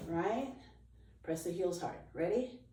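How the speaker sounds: ordinary talk, nothing marked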